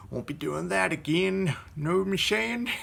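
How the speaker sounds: a mock Irish accent drifting into Italian; joking through a muddled put-on accent after a laugh; self-mocking mixed-up accent; hybrid put-on accent. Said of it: "all my accents are more like Italian, Mexican, Arabic hybrids".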